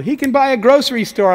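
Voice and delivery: a friend shouting the punchline over the phone call; friend's yell. Retold or imitated. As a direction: call it across to him as a joke. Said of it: "he yelled".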